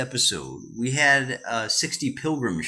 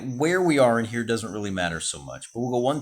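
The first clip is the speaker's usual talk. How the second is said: ordinary delivery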